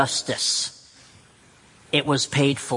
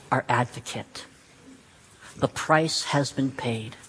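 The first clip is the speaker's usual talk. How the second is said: usual speaking voice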